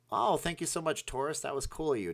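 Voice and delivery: high, bright burst of thanks; excited thanks. Real, not acted. Thanking a new Patreon member, high and excited.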